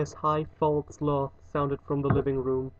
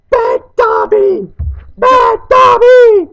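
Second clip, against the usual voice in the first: shrieked self-scolding, very high and loud; slow, piercing elf screams; shouting; 17 dB louder, slower than usual. A narrator's reading. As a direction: scold yourself at full shriek.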